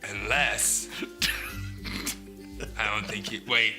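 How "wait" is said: ordinary delivery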